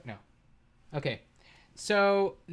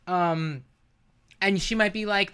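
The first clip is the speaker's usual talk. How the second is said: ordinary delivery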